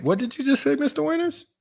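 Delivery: high, rising incredulous question; mock disbelief. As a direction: question him in a baffled high voice. Mock disbelief at viewer's remark.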